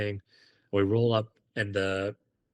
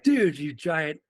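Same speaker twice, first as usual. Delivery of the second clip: dude, you idiot, over the fence; exasperated yell at a buddy; 8 semitones higher than usual. Retold or imitated. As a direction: chew out your giant idiot friend. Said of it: "And I was like".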